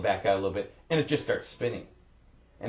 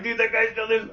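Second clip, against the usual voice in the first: mocking onlooker; 12 semitones higher, 5 dB louder than usual. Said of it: "the guy that they're like".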